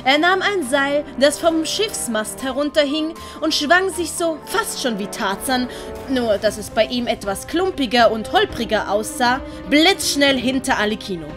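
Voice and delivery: ordinary delivery, nothing marked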